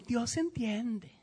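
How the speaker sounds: slow, soft, self-excusing sigh of an excuse; mock excuse. Pastor mocks believers excusing disobedience, slow soft sigh. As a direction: say it like a lame excuse. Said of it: "justificamos la desobediencia con".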